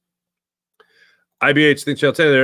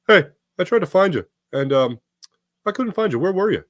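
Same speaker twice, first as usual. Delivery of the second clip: higher-pitched imitation of dad; dad's voice; 6 semitones higher than usual. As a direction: put on dad's puzzled voice. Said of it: "that's my dad's first voice".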